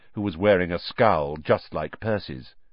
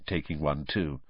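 ordinary delivery

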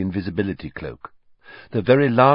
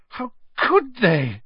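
slow, much higher, breaking into a cry; high tearful wail; slower than usual. A narrator's reading. Hermione repeating it, rising to a tearful cry. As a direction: let it break into a sob. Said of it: "she choked".

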